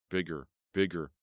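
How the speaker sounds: ordinary delivery, nothing marked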